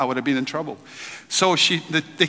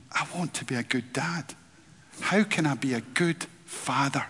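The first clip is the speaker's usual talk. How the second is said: quiet inner thought; 2 semitones lower, 9 dB quieter than usual. Retold or imitated. Remembered inner thought as young dad.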